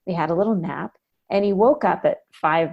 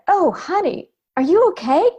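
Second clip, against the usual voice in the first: kindly stranger; 11 semitones higher, 5 dB louder than usual. Retold or imitated. Stranger voiced, kindly concern.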